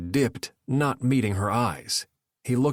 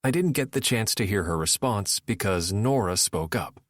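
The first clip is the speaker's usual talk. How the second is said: ordinary delivery, nothing marked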